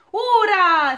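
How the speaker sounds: a joyful cry of hurrah; cheering shout. A narrator's reading. Crowd cheering the balloon lifting off, loud cheer. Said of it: "strigară piticii".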